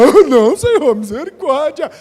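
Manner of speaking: high, loud mock plea for mercy; pleading sinner. Preacher mimics a frightened sinner begging God. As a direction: beg the Lord in hammed-up panic. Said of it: "Aí você fala".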